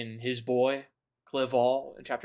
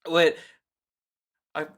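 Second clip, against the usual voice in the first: ordinary delivery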